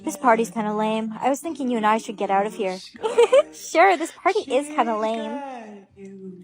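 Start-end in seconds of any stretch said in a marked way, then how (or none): 2.8-3.5 giggle. Acted. Laughs at the proposal.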